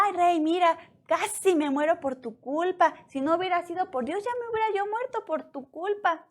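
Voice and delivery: high, aggrieved blaming; hammed-up complaint thrown at a king; mock reproach. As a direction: act out an indignant, high-pitched accusation.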